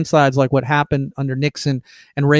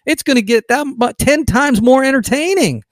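much higher, scoffing disbelief; incredulous retort; 7 semitones higher than usual. Retold or imitated. Answering leftists who ask if he'll quit, incredulous scoff. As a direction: laugh off the question as absurd. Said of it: "I go".

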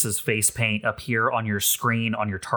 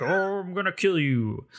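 mock threat in a higher, put-on robot-hero voice; Optimus Prime impression; 7 semitones higher than usual. Game master imitating the ship as a transforming robot, mock-menacing impression. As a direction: play a hammed-up transforming robot making a threat. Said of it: "that's my impression".

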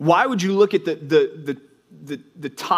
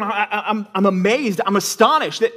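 higher and faster, disbelief mounting; a preacher voicing Paul scolding the Galatians, rising in disbelief; astonished Paul; 6 semitones higher than usual. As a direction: voice Paul as if he can't believe the news. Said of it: "He's going".

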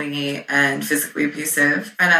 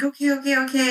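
retold placating; 9 semitones higher, much faster than usual. Imitates boyfriend backing down from fury.

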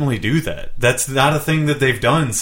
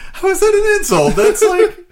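much higher, laughing incredulous question; laughing disbelief; 5 dB louder than usual. Real, not acted.

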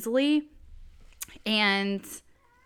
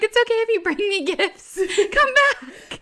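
laughing call; 11 semitones higher, 7 dB louder than usual. Retold or imitated. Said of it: "And I was like".

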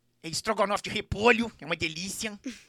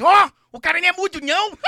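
higher, louder retold astonished cry; mock shock; surprised exclaim; 10 semitones higher, 9 dB louder than usual. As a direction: blurt it out like stunned castmates. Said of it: "Aí eles".

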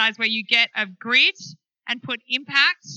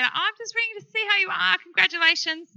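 bright friend; 8 semitones higher than usual. Retold or imitated. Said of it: "She rang me and she said".

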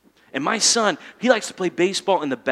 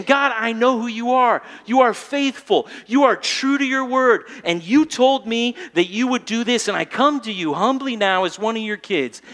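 fervent prayer, higher and stressed; earnest prayer; 4 semitones higher than usual. Shown as a demonstration. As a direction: pray with firm confidence. Demonstrates prayer.

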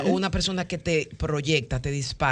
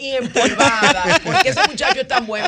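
laughing; 4 semitones higher, 5 dB louder than usual. Real, not acted. Laughing at the case while still in role.

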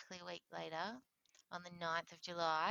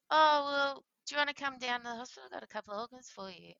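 imitating a caller's offhand invitation; quick, higher-pitched, breezy delivery; casual; 7 semitones higher, 9 dB louder, faster than usual. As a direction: toss it off like small talk. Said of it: "Like, so casual".

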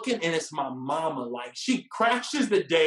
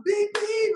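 high, loud beep-beep; imitated car horn; 5 dB louder than usual. Driver in next lane honks, imitated horn beeps. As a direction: honk it with the voice. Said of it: "honking his horn".

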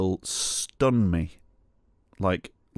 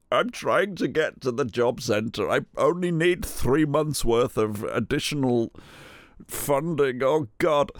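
high pleading; 6 semitones higher than usual. Retold or imitated. Pleading to be let out.

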